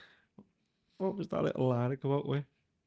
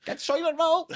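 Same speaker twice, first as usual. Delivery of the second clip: squeaky mock voice; 5 dB louder than usual. Quoting shoppers, high squeal.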